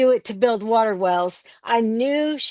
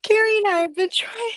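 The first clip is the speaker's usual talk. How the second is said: emotional; 10 semitones higher, faster than usual. Real, not acted. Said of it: "breaking down".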